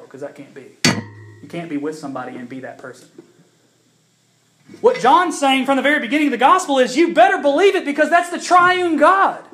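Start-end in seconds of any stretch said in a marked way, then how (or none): 4.8-9.4 loud, high pulpit proclamation; full-force preaching. Acted. To the congregation about creation, preached loud. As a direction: preach it out with conviction.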